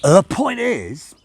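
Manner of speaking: put-on loud, high, slow drunken drawl; drunk Crowley. Crowley drunk at the table, loud slurred drawl. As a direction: slur it out like a drunk demon.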